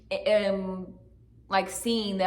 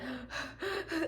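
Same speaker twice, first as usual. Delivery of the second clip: sharp, high, breathy intake of air on waking; startled gasp; 8 semitones higher, 9 dB quieter than usual. Retold or imitated. Waking from the dream, she reenacts her gasp, high and sharp. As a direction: gasp as if jolted awake. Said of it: "I woke up and I was just like".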